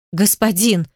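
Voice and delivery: usual speaking voice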